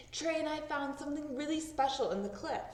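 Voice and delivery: put-on eager girl. Girl telling grown-ups eagerly.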